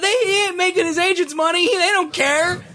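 excited high rant. Real, not acted. Pitched-up heated rant.

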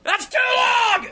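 slow, high shout of outrage; outraged shout. Real, not acted. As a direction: stretch it out in a high indignant yell. Outraged reply to co-host.

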